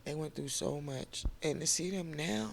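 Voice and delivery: high, soft and teary, voice breaking; catching breath midword through tears; crying. Real, not acted. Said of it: "I'm sorry, I'm crying".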